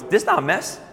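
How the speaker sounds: put-on little five-year-old's defensive squeak, lightly done; cute five-year-old. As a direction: imitate a small boy protesting to his dad. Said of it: "in a cute little five-year-old voice".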